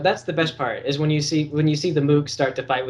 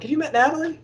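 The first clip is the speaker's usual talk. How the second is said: chirpy friendly pitch; 13 semitones higher than usual. Retold or imitated.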